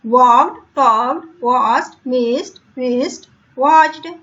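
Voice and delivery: slow, careful word-by-word pronunciation drill. Shown as a demonstration. Teacher modelling the rhyming words for pupils. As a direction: model each word clearly to copy. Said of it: "यहाँ पे आप बोलोगे ऐसे".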